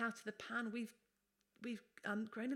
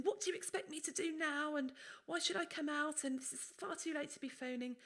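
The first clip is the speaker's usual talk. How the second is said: imitating snapping at patient; 8 semitones higher than usual. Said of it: "I was awful to the patient".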